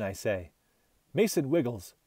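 ordinary delivery, nothing marked

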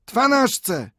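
very high chime; ping it like pocket watches; little watches. A narrator's reading. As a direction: watches answering twelve. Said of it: "odpowiedziały wspomniane zegarki".

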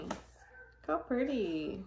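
ordinary talk, nothing marked

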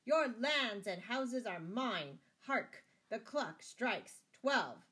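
loud, slow, triumphant anger; shouted in rage. A narrator's reading. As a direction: proclaim it loudly, drawing out each word. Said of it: "shouted the abbot, purple with rage".

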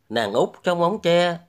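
ordinary delivery

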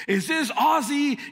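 an irritated customer checking who answered; mad customer. Retold or imitated. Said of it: "he was so mad".

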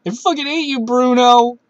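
a gamer cursing Bruno after a defeat; real anger. Real, not acted. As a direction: spit it out at the trainer. Cursing the Elite Four trainer.

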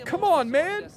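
whiny plea to the friend; Pickles impression. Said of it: "Your pick impression".

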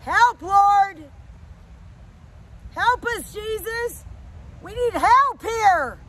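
loud, urgent call for help; crying out. Real, not acted. Preacher begging the Lord to help the congregation, crying out. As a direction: call out to heaven at full volume.

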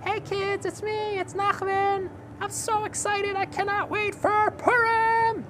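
high excited puppet voice; squeaky eager kid puppet, bouncing with excitement; Nachman puppet voice. Acted. Puppet character speaks.

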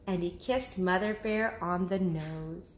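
usual speaking voice

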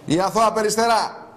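mocking proclamation. Host mocking a woman in a photo, proclaiming. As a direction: announce the nickname like a title. Mocking repeat, now higher.

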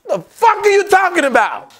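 high, loud disbelief; shrill what-the-hell; baffled outburst. Real, not acted. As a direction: throw up your hands in confusion. Baffled reaction to dad.

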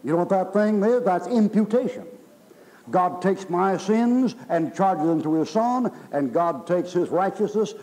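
fire-and-brimstone pulpit drive; full-force preaching. Real, not acted. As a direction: preach it hard, dragging out the last words. Preaching to the congregation.

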